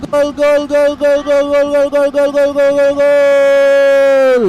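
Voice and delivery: rising into a long shouted goal call. Real, not acted. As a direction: scream gol over and over, stretching the last. Commentator calls a goal.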